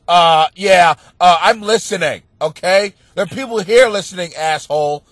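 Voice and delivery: imitating a coworker's loud, teasing scolding; mock loud scolding. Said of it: "She was like".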